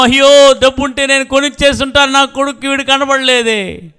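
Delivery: high, loud anguished wail, rushed; anguished cry. Acted. Father who lost his son in town, wailing in distress.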